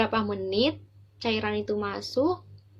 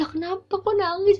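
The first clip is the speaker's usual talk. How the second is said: high, loud surprised question; concerned bystanders; 10 semitones higher, 6 dB louder than usual. Retold or imitated. People rushing over to the crying patient, high startled asking. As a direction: call out startled, asking what's wrong. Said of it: "Orang-orang nyamperin".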